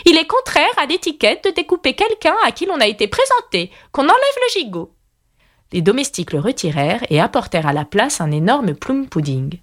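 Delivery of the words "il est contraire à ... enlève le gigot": high-pitched imperious queen; peremptory. A narrator's reading. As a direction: lay down the law as royalty. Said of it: "répondit la Reine Rouge d'un ton péremptoire". (reine péremptoire, voix haut perchée)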